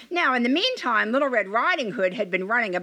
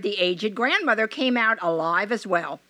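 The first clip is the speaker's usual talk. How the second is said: plain reading voice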